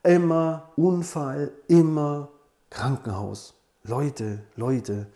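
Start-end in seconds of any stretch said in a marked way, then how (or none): none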